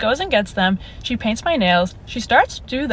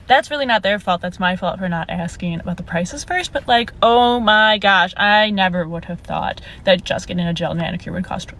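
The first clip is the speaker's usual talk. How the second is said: ordinary delivery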